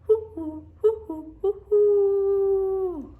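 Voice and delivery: high, loud owl hoots, last one held; the owl echoing through the trees, held hoots. Acted. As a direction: answer back like a distant owl. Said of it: "an echo came threading".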